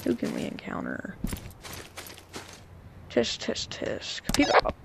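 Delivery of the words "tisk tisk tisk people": hushed, sinister whisper; creepy whisper. Acted. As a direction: whisper it like a stalker. Stalking enemies in game.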